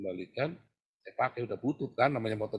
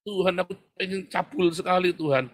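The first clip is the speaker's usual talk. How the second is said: raised, higher blurted confession; loud confession; 7 semitones higher, 7 dB louder than usual. Retold or imitated. Preacher modelling a bold confession to God, raised. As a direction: cry it out to God.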